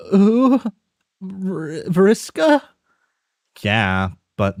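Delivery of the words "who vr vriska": high, startled questioning voice; high confused caller. Acted. A confused friend on the phone asking who is calling, high and puzzled. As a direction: sound baffled on the phone.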